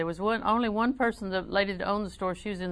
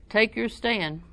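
ordinary talk

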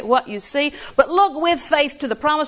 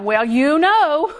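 slow, higher, louder knowing drawl; mocking a friend; 5 semitones higher, 5 dB louder, much slower than usual. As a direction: mimic the smug friend dragging it out. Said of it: "quotes it to me all the time".

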